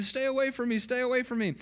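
high, held-up pitch, imitating a frantic brother; mock yelling. Brother pushing mom away from his stung foot, mock yelling. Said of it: "just sitting there yelling and pushing".

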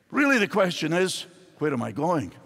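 ordinary talk, nothing marked

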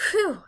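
a high, loud whew of relief on a long breath; relieved whew. A narrator's reading. Polly to Diggory, relieved once the witch leaves: loud whew. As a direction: blow it out like the danger just passed. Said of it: "letting out a long breath of relief".

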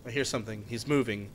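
hushed voice. Real, not acted. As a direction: keep it low so he can't hear. Sneaking past a monster.

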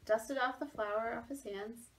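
ordinary delivery

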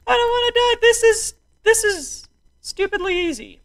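very high, squeaky voice; high squeal. Real, not acted. Player escaping the flood, high squeal. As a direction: squeal it out at the top of the range.

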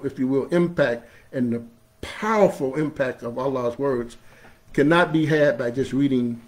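ordinary talk, nothing marked

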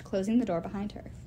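usual speaking voice

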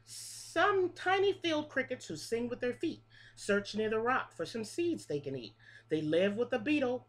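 lilting picture-book read-aloud, each line rising then falling; reading to children. Acted. Teacher reading a bug rhyme book to her class, sing-song. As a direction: read the rhyme like story time for little kids.

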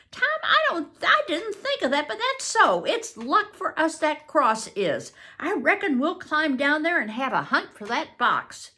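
put-on high excited boy's voice, relieved; voiced Huck. Huck to Tom, relieved by the cross, high eager. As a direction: brighten up with sudden hope.

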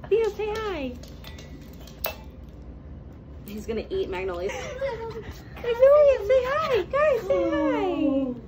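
high sing-song puppy talk. Real, not acted. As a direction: coax the dog in a high voice. Talking to the puppies.

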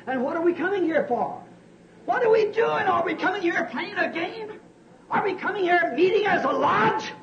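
high, searching questions; rhetorical challenge. Real, not acted. Preacher asking his church why they gather, high challenging. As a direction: challenge them, half scornful.

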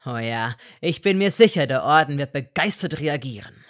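loud bitter mockery; mock her hope loudly; loud sarcasm. A narrator's reading. Malfoy loudly mocking Hermine.

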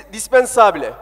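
ordinary talk

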